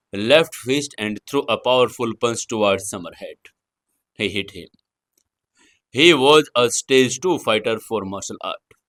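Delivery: usual speaking voice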